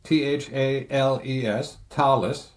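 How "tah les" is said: Thales said as TAH-less, with a plain T; Swiss pronunciation. Shown as a demonstration. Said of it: "the Swiss will probably say".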